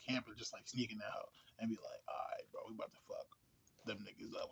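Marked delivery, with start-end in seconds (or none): 2.1-4.5 sneaky whisper. Retold or imitated. Warriors sneaking into enemy camp.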